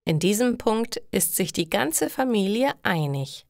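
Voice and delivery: usual speaking voice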